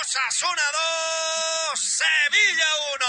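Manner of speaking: loud, fervent radio goal recap; shouted goal commentary. Real, not acted. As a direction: roar the goal story to the fans.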